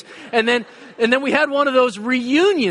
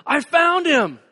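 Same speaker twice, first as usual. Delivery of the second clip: relieved call; 7 semitones higher, slower than usual. Retold or imitated. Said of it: "her voice".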